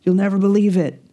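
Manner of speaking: put-on a kid bursting in yelling for mom; excited screaming child. Boy bursts in, calling to his mom, high and excited. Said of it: "opens the door and screams".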